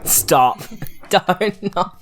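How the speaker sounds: ordinary talk, nothing marked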